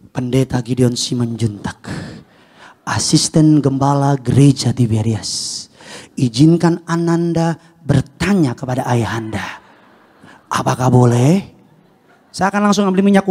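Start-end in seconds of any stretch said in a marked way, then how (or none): 0.1-11.5 mock-serious formal child. Child formally asking papa for permission, absurdly solemn. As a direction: intone it like a stiff official petition. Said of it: "ngomongnya serius".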